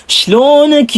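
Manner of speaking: high-pitched, lilting Hasawi "Shlonak"; sweet Hasawi accent. Shown as a demonstration. Vlogger demonstrating the Hasawi greeting, sweet and high. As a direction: sing out "Shlonak" in a smooth Hasawi lilt. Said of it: "smooth at napaka-sweet ng kanilang mga accent".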